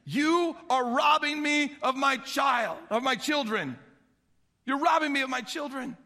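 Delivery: put-on old father crying out to his sons; anguished exclaim. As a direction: cry it out like a broken father. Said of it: "Jacob exclaimed".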